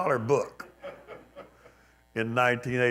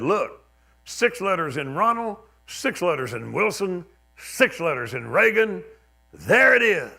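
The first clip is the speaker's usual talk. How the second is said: high, loud imitation of an excited preacher; hammed-up preacher's discovery, peaking loud at the end; mock triumphant reveal; 7 semitones higher, 7 dB louder than usual. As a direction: count off the proof, then crow the conclusion. Imitates preachers triumphantly proving their theory.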